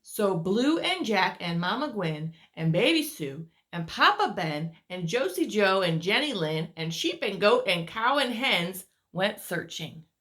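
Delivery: usual speaking voice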